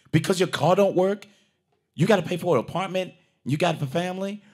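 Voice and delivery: high, loud mock scolding; mock scolding mother. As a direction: scold him like an exasperated mom.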